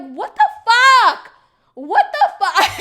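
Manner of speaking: loud, disbelieving what the fuck; exasperated outburst. Real, not acted. Venting to viewers about him, loud exasperation. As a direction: blurt it out, fed up and half laughing.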